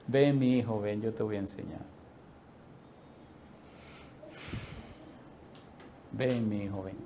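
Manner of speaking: low, soft and tender calling; gentle voice of God. Retold or imitated. God to his son, soft tender invitation. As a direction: coax a child in close.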